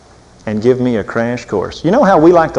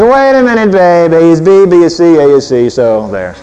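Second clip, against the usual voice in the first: mock lecturing husband; 5 dB louder than usual. Husband lecturing wife with logic, mock smug. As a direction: talk down to her like a smug husband. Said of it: "Don't be giving her a bunch of answers".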